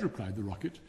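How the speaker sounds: ordinary delivery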